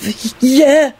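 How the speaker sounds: put-on high, stammering boy; chiming in. A narrator's reading. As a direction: chime in hesitantly. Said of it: "Melvin chimed in".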